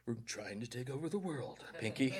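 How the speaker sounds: a hint of a plotting cartoon brain; cartoon villain impression. Preacher jokes mid-sermon as cartoon mouse, soft scheming voice. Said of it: "It's a dated joke".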